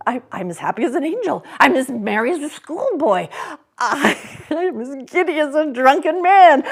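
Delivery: fast, loud, high laughing rapture; giddy laughing. Acted. Scrooge laughing and whooping to everybody, giddy. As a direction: burst with giddy joy, ending in whoops. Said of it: "laughing and crying in the same breath".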